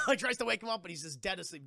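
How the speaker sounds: ordinary talk, nothing marked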